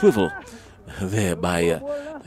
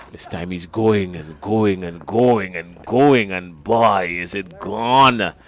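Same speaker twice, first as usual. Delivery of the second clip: building excitement; 6 dB louder, slower than usual. Real, not acted. Commentator calls a big hit.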